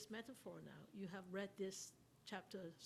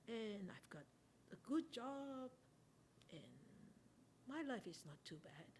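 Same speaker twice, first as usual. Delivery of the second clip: complacent musing; 6 dB quieter than usual. Acted. Teacher voicing a contented worldling's thoughts, softly complacent. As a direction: lull yourself with how nice it all is.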